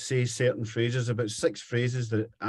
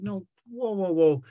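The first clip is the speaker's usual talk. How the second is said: mock protest; 9 semitones higher, 3 dB louder than usual. Mocking friend's American accent.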